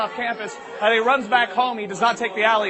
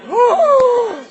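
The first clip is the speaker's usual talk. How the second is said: high, loud "woo" scream; shocked shriek; 11 dB louder than usual. Acted. Teacher reacts to the word with a shriek. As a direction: let out one long piercing whoop. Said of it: "And the teacher goes".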